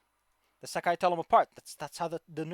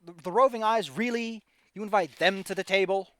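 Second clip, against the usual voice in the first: indignant outburst; 7 semitones higher, 12 dB louder than usual. Acted. Orc emissary complaining about rival tribe.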